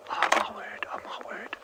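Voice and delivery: breathless whispered oh my word; awed whisper. Real, not acted. Vlogger whispering to viewers in awe at the ducklings, hushed. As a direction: hush it out in wonder, barely voiced.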